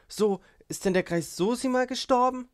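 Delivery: high, loud astonished question; startled, pitch leaping up; shocked exclamation. A narrator's reading. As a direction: cry out in shock at the news. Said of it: "rief Gruschenka aus".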